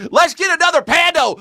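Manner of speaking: high-pitched gleeful cheer; mock cheering. Comedian rallying viewers for another pandemic, mock cheering. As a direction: whoop it out high, like rallying a crowd.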